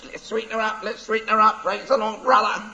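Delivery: high squawky parrot chant. Acted. Parrot voiced in story.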